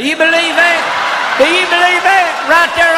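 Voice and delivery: high, loud mock disbelief; incredulous shout. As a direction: squeal it in mock shock.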